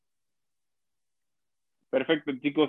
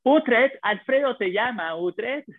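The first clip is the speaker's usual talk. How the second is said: calling out; 8 semitones higher, slower than usual. Pretend. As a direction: call him from afar. Calling Utrecht in mock scene.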